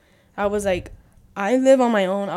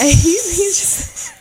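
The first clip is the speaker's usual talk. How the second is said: higher, louder, words breaking into real laughter; laughing; 10 semitones higher, 7 dB louder, much slower than usual. Real, not acted. Friend retelling a phone call to a friend, laughing. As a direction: really crack up while trying to talk.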